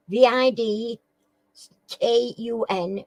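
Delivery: letters V I D K U N spaced out one by one; host spells the name for players; spelled out. Shown as a demonstration. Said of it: "spells out the name".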